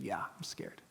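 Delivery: put-on whispered softly like a frightened young woman; scared whisper. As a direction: breathe it out, small and afraid. Scared girl answering quietly.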